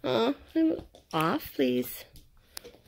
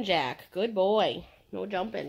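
ordinary talk, nothing marked

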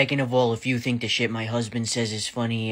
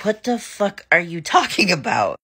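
high, disbelieving outburst with a laugh; utterly thrown by his answer; baffled; 8 semitones higher, 4 dB louder than usual. Retold or imitated. To her dad after the nuts remark, baffled and laughing.

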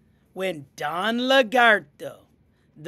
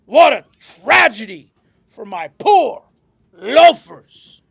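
loud, overdramatic pig wail; squealing pig; 9 dB louder than usual. A narrator's reading. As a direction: lament loudly like a vain pig. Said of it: "squealed Don Cerdo".

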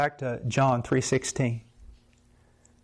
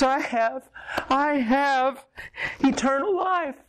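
climbing ever higher, voice breaking with wonder; each repeat rises as it sinks in; joyful disbelief, pitched way up; overwhelmed joy; 11 semitones higher, slower than usual. Retold or imitated.

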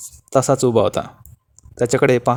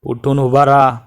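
roaring order; 3 semitones higher, slower than usual. A narrator's reading. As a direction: bark the order at the fallen man. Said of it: "गरजला".

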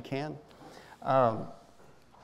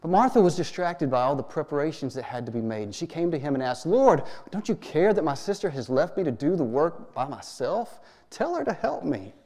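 louder, faster Bible reading; scripture reading; 3 semitones higher, 7 dB louder than usual. Acted. Pastor reading Luke to the congregation, animated reading. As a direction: read the passage with energy. Reads Bible passage aloud.

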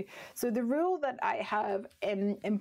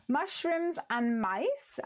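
slow, savoured reading of the name, a touch higher; drawn out; 6 semitones higher, much slower than usual. Shown as a demonstration. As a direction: linger on "Mushrooms" and stretch it out. Reads stamp set name aloud.

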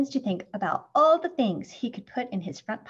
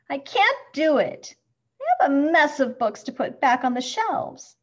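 high, louder fretting; overwhelmed sighing complaint; 5 semitones higher, 7 dB louder than usual. A narrator's reading. Beetle facing a pile of books, overwhelmed complaint. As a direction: complain as if swamped by the task. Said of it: "sighs Big Benny".

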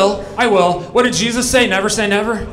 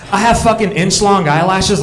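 ordinary talk, nothing marked

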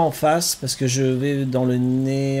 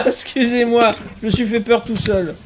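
high laughing apology after a fright; embarrassed laugh; 8 semitones higher, 7 dB louder than usual. Real, not acted. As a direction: laugh it off, sheepish. Laughs off own scare. (rire gêné, voix haute)

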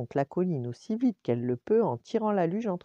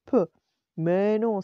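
loud, lightly acted brush-off; dismissive exclamation; 3 semitones higher, 7 dB louder, slower than usual. A narrator's reading. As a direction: exclaim it to wave the worry away. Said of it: "s'écria Clochard". (exclamation un peu moqueuse, plus forte)

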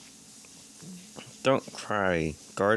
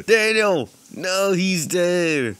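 loud cry of alarm, slowed at the end; shouting in dismay; 8 semitones higher, 5 dB louder, slower than usual. Real, not acted. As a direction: yell his name then wail the news. Character dies again.

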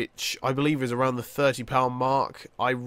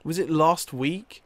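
ordinary talk

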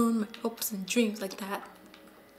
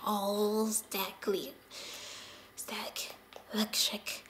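whisper; 7 dB quieter, slower than usual. Real, not acted. Whispering mid-game.

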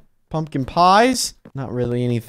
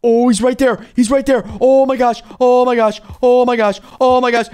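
ordinary talk, nothing marked

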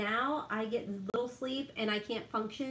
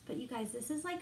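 ordinary talk

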